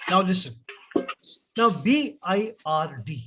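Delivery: normal talking voice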